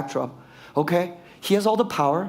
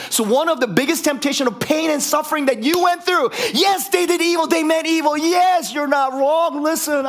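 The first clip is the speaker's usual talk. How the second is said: higher, louder, driving preacher's cry; fired up preaching; 11 semitones higher, 4 dB louder than usual. Real, not acted. Preacher getting fired up at his congregation, loud and high. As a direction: preach at full force, on fire. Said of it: "I'm getting fired up".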